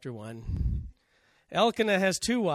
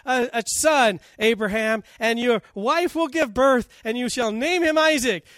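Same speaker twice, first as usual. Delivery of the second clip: a raised, higher, louder voice of God making a promise; 6 semitones higher, 8 dB louder than usual. Acted. God promising Abraham a son, in a raised, louder voice. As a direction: announce the promise grandly, lifted and loud. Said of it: "And God says".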